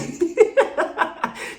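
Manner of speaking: high, breathy cackle; real laugh. Real, not acted. Host mocking the camera-hungry mother, real laughter. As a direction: crack up at your own impression.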